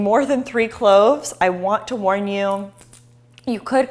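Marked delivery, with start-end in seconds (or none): none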